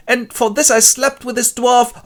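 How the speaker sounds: high, loud, slow indignation; scandalized outburst. Acted. Scandalized party guest regrets sleeping with Bernard, indignant outburst.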